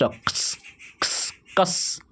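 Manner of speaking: kas whispered, the k half-swallowed; whispered कस as half क plus स; whispered demo sound. Shown as a demonstration. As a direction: breathe out कस softly, tongue pressed down. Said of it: "आप बोल के देखिए इसको".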